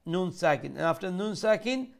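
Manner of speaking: normal talking voice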